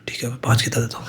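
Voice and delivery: soft unvoiced whisper of giving in. The defeated devotee whispering his agreement to the monk. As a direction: breathe it like a meek surrender.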